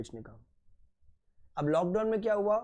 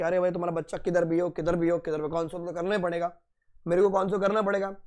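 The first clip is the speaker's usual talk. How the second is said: ordinary delivery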